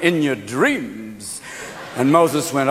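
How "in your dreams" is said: slow, drawn-out brush-off; lightly voiced Pharaoh, dragging the words; dismissive Pharaoh. Pharaoh refuses Moses, slow and dismissive. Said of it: "And Pharaoh went".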